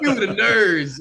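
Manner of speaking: high, loud, laughing mock of the teacher. Imitating his teacher packing him off to theater class, high and laughing. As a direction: ham up the teacher shooing him off to the thespians.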